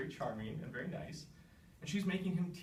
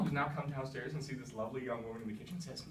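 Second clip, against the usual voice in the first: ordinary talk, nothing marked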